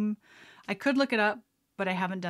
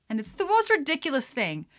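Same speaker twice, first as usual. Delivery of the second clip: normal talking voice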